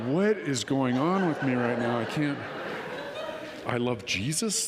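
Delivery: baffled at himself, laughing through it; laughing disbelief. Retold or imitated.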